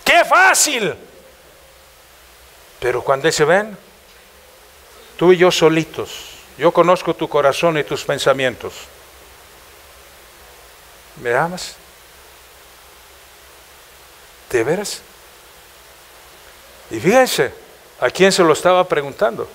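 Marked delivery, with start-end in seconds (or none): 0.0-0.9 mock the ease of it, exclaimed much higher; indignant exclamation. Real, not acted. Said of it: "exclamación del predicador". 3.4-15.0 tender intimate voice. Acted. Dios habla íntimo a solas.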